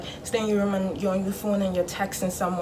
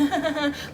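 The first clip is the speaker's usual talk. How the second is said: higher, put-on chuckle; mock social laugh; 7 semitones higher, 3 dB quieter than usual. Child hanging around the parents' visitor, mock laughing. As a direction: imitate laughing along with the grown-ups.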